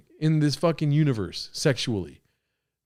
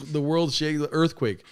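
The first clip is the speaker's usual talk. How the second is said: usual speaking voice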